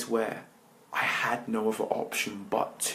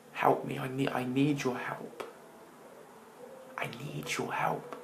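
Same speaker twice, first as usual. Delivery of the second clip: retold tearful plea; 3 semitones higher than usual. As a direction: mildly relive the begging. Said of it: "And I was crying".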